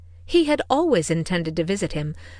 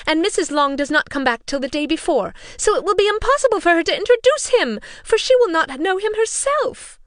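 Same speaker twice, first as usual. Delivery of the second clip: much higher, louder fretting mother voice; fussy mother; 11 semitones higher, 6 dB louder than usual. A narrator's reading. Mother complaining to her husband, high and agitated. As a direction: fuss it out, pitch climbing.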